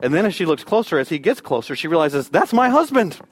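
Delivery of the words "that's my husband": high, excited outburst; astonished recognition. Retold or imitated. Stranded wife realizes her husband has come, astonished and excited. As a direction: burst out in amazed relief, pitch leaping up.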